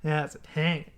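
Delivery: put-on Australian accent. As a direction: say it like a typical Australian guy, quietly. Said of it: "sounded like a typical Australian guy".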